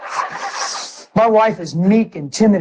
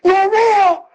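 joyful scream; 12 semitones higher than usual. Retold or imitated. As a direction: scream it out in joy. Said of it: "I screaming".

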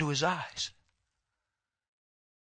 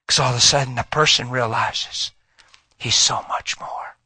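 ordinary delivery

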